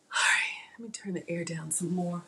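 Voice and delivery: unvoiced whispered aside. Real, not acted. Cook whispering an aside to herself, away from the mic. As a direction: whisper it off to the side. Quiet aside while cooking live.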